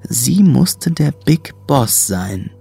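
normal talking voice

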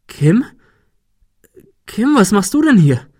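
high, loud, startled question; bewildered surprise; astonished. Acted. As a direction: call the name in disbelief, then question. Gunman astonished to see Kim.

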